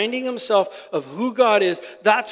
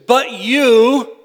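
"but you" loud and drawn out for emphasis; emphatic BUT YOU; 3 semitones higher, 6 dB louder than usual. Shown as a demonstration. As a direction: lean hard on "but you".

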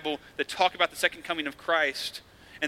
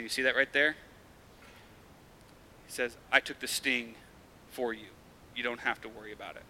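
tender reassuring dad; 3 semitones lower, 6 dB quieter than usual. Retold or imitated. Dad showing son the sting.

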